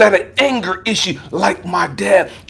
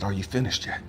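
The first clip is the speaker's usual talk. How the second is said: calm voice of God; 10 semitones lower, 13 dB quieter, faster than usual. Retold or imitated. God's calm reply.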